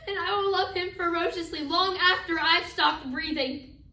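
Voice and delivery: loud, impassioned stage declaration; a grieving mother swearing her love; fierce vow. Acted. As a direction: drive it out with full force. Acted mother vows undying love.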